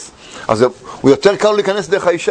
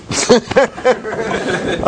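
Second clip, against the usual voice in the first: laugh; 5 semitones higher than usual. Real, not acted. Laughing at own story.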